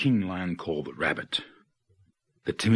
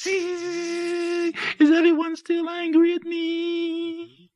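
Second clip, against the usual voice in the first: very high, slow insect whine; drawn-out high mosquito voice; whining mosquito; much slower than usual. A narrator's reading. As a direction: whine it like a mosquito in someone's ear. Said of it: "whining in people's ears".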